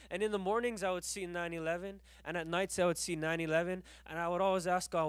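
usual speaking voice

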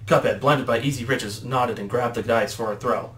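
usual speaking voice

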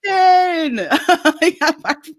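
high surprised exclamation of the name. Real, not acted. Hearing Preston was fired too, a high surprised cry. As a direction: react to the news with a jolt.

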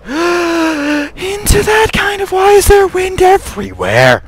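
very high, drawn-out squeal; alarmed wail. Real, not acted. Player's outro cut off by wind, high alarmed wail. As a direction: break into a high, stretched cry of alarm.

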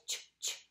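ch, ch, ch as a soft hissed burst; ch sound. Shown as a demonstration. Teacher modelling the ch sound for kids, breathy and unvoiced. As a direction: say ch, ch with breath only, no voice. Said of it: "C H".

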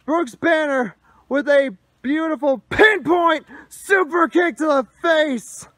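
high, shouted wrestling call easing into a replay call; excited play-by-play. Real, not acted. Commentator calls a surprise super kick, excited play-by-play. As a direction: erupt at the big move, then keep it urgent.

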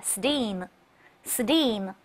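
steam, steam stretched out very slowly; very slow model reading. Shown as a demonstration. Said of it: "来念一下". (极慢示范steam)

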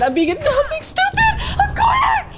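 very high, frantic shriek; panicked scream. Real, not acted. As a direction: shriek it as if trapped. Trapped by enemies in game.

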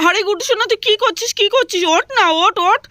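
high, pleading father; father pleading with his crying son, high and strained; pleading with child. Acted. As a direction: beg the toddler to get up, voice pitched high.

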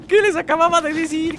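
very high, squealed complaint; high-pitched dismay. Real, not acted. Gamer to viewers after warning comes true, high dismay. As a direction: wail the question way up high.

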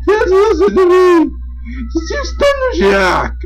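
high loud cries at the screen; real pleading shout; desperate pleading yell. Real, not acted. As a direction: beg the Pikachu, yelling. Gamer pleading with Pikachu.

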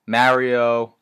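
ordinary talk, nothing marked

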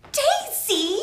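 high, loud call across the house. A narrator's reading. Lily calling Daisy from the garden, loud. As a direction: call her friend from outside.

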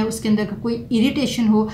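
normal talking voice

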